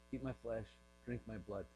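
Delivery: low, hushed, unyielding refusal; quiet insistence. Retold or imitated. Jesus refusing to explain to the crowd, quietly insistent. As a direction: imitate a teacher who softly won't budge.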